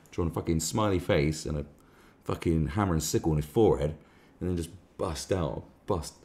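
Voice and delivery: ordinary talk, nothing marked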